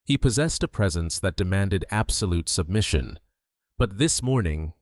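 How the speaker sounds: plain reading voice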